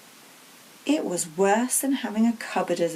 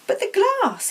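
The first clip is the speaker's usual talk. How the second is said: shocked; 14 semitones higher, 4 dB louder than usual. Acted. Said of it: "was in shock".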